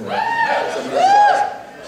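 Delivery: words squeezed out through a high laugh. Real, not acted. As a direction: laugh while naming them, voice jumping up. Teller laughing at his own joke.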